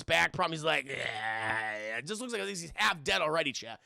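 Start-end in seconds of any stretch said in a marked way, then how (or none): 0.9-2.0 low, drawn-out mock groan; decrepit old king. Hunched old king, half dead, groaning mock voice. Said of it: "spinal problems".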